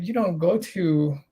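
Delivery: normal talking voice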